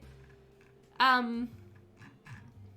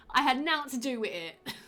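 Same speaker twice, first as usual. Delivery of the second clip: put-on Cockney, slightly higher; ridiculous Cockney accent; 3 semitones higher than usual. Shown as a demonstration. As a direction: play up a silly Cockney line. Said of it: "read this in this ridiculous accent".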